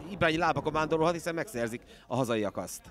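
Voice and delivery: normal talking voice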